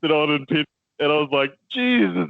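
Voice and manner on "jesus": shocked exclaim. Retold or imitated. Reacting to near loss.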